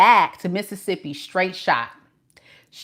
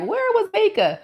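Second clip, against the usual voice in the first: high-pitched imitation of puzzled fans; subscribers' mock demand. Voicing subscribers wondering where she was, high mock demand. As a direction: voice the fans' question up high.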